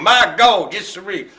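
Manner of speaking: put-on hillbilly twang; playful hillbilly drawl; hillbilly accent. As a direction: slip into a mock hillbilly accent. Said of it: "I don't know why I'm doing that hillbilly accent now".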